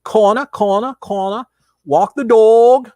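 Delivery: hammed-up New York accent calling out the corner; playful New York accent demo, stretching walk the dog. Shown as a demonstration. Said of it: "I could do a New York accent".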